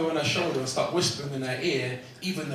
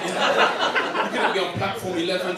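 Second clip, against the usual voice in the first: ordinary talk, nothing marked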